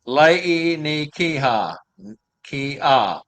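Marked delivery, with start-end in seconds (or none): 0.0-1.8 "Lei ni Kiha" sounded out slowly, one syllable at a time. Shown as a demonstration. Host reading Hawaiian reindeer name, slow and careful. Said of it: "make it a little easier for me to pronounce them".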